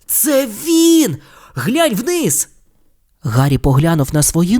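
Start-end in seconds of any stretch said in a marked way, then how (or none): 0.1-2.5 much higher, squealing with glee; squeaky excitement. Acted. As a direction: squeal it like a thrilled boy. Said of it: "і Рон аж запищав".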